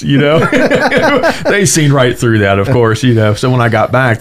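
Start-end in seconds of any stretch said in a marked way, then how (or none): 0.5-1.4 a short high burst of laughter; quick high chuckle. Real, not acted. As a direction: laugh at your own flimsy excuse. Laughs at his own cover story.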